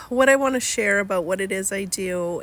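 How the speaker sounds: usual speaking voice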